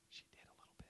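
whispered teasing aside. Real, not acted. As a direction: breathe it out as a secret. Teasing aside about Alethea.

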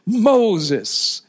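high, loud, urgent preacher's cry, easing at the end; God's impassioned plea. Acted. Preacher voices God finally revealing himself to Moses, impassioned pleading. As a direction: plead with mounting longing, stretching the name. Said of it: "as if God was saying".

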